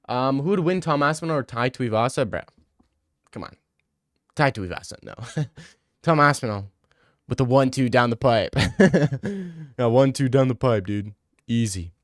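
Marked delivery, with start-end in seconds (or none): none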